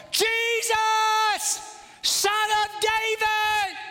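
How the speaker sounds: long, high-pitched bellow. Acted. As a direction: stretch each word into a sustained cry. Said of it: "So he shouts all the louder".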